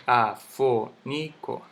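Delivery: afónico split as a-fó-ni-co; syllable by syllable. Shown as a demonstration. Teacher sounding out the word for students, slow separate syllables. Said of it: "La misma palabra".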